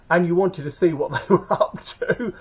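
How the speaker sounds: laughing through the words; amused laughing. Real, not acted. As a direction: chuckle at your own cheeky joke. Cheeky joke about watching shower.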